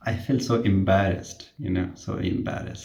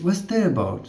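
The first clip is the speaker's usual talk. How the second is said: high, puzzled asking; asking what it means, pitched up; confused question; 9 semitones higher than usual. Retold or imitated. Nurse asking patient confusedly, high puzzled tone.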